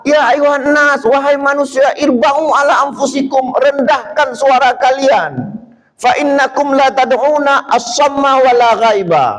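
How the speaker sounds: high, stern rebuke; angry Prophet. Retold or imitated. Prophet rebuking companions for shouting, high and stern. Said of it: "Nabi marah".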